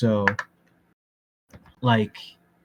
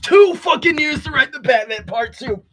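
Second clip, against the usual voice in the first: high, loud burst of outrage; outraged rant; 12 semitones higher, 4 dB louder than usual. Real, not acted. Ranting to friends about the Batman sequel's delay, outraged. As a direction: shout the grievance at an absent filmmaker.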